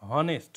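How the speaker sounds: হনেস্ট with a loud, clearly sounded h; wrong pronunciation demo. Shown as a demonstration. Teacher showing the wrong way to say honest, loud demo. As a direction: punch the h at the start of হনেস্ট. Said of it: "যদি এইচ এর উচ্চারণ হতো তাহলে কিন্তু এখানে হনেস্ট উচ্চারণ হতো".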